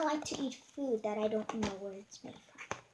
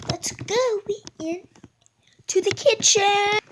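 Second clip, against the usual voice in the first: slow, loud announcing; 8 semitones higher, 12 dB louder, slower than usual. Real, not acted. Announcing slowly, loudly.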